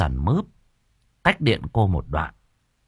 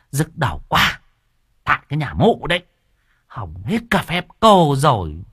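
irritated grumbling; 6 semitones higher, 3 dB louder than usual. A narrator's reading. Cô An grumbles after ruined ritual.

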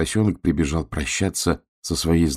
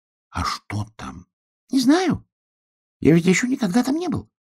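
little duck voice. Acted.

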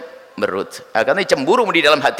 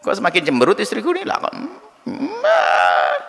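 grumbling husband; 4 semitones higher than usual. Retold or imitated. Husband grumbling at sulky wife.

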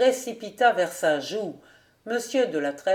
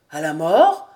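loud, puzzled echo rising sharply; uncomprehending question; 3 semitones lower, 9 dB louder than usual. A narrator's reading. Cousin repeats the old man's words, baffled and rising. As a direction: question it in startled incomprehension. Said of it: "répéta Vaunois sans comprendre".

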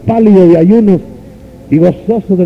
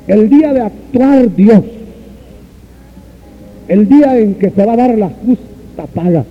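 preaching at full force; 6 semitones higher than usual. Real, not acted. Preacher warning dear brothers about temporal riches, high fervent preaching. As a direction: cry it out over the hall.

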